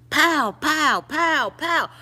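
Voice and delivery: loud, high-pitched "pow" bursts; imitated gunshots. Storyteller imitating the shooting outside, loud gunshot noises. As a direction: punch out each pow like a shot. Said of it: "the only thing you hear was".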